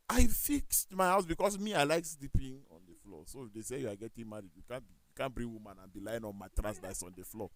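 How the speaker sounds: normal talking voice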